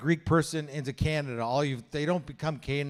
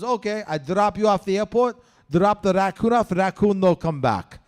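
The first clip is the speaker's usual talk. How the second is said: higher, louder broken-English Greek dad explaining his plan; Greek dad accent; 4 semitones higher, 4 dB louder than usual. Retold or imitated. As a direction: lay out the plan like a proud Greek father.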